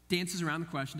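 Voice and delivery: ordinary delivery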